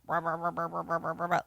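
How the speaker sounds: low, soft rolling motor noise; machine whirring. Retold or imitated. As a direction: imitate a toaster-like machine humming away. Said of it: "like, whirring, like, just being like".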